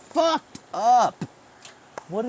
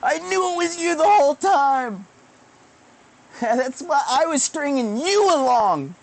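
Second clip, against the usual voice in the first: much higher, louder triumphant yelling; triumphant shout; 12 semitones higher, 6 dB louder than usual. Retold or imitated. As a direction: scream it like you caught them red-handed. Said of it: "And I'd be like".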